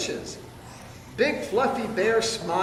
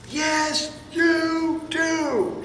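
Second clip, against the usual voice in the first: much higher, playful shout; 10 semitones higher, much slower than usual. Acted. Fluffy bear winning the quarrel, high playful shout. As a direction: squeal it out at him. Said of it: "shouted Big Fluffy Bear".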